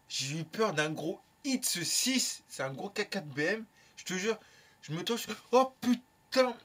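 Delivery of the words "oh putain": drawn-out startled curse, louder and higher; slow stunned swear; shocked oh putain. Retold or imitated. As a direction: replay the jolt of spotting the big car. Retold shock at the big car.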